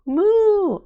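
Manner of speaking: high, loud playful cow moo; child mooing. A narrator's reading. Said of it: "I say".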